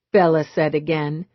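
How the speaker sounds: ordinary delivery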